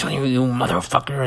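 hammed-up angry outburst; dramatic. Comic demonstrating dramatic to the audience, mock-angry. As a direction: curse someone out in mock fury. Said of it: "dramatic is".

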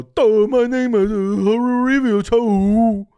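loud, high, slow dopey voice; dumb caricature voice. Retold or imitated. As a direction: ham up a dim-witted caricature. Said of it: "it's not as racist to go".

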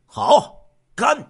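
short, loud toast call; 喊干杯. Acted. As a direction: call out heartily to drink. 酒桌上众人喊干杯. (大声喊干杯)

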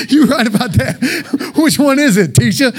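laughing through the words; chuckling question to an imagined teacher. Real, not acted. Preacher laughing as he asks the teacher which spelling.

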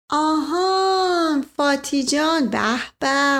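light up as you remember her; delighted recognition. Acted. Asadollah Mirza suddenly remembering Fati, drawn-out delight.

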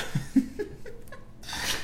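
high, squeaky laugh; laughing fit. Real, not acted. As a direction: crack up in a thin, very high laugh. Cracking up at haircut picture.

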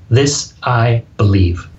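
slow and firm, "This" falling down to a full stop; firm statement. Shown as a demonstration. Speaker showing a podcast host a confident ending, slow and firm. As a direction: say it like a conviction. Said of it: "you're saying".

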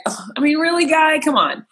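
high-pitched exasperated complaint; irritated. Retold or imitated. Muttered reaction to the driver who cut her off, irritated. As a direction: groan and protest, pitched well up. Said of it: "you're automatically irritated".

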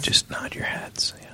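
slow, soft whisper. Real, not acted. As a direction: whisper it to the crowd. Hushed aside to audience.

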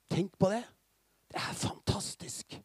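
hushed, marvelling whisper; awed whisper. Real, not acted. Preacher marvels at the story.

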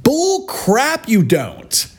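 jumps up high in disbelief; high scoffing outburst; incredulous retort. Real, not acted.

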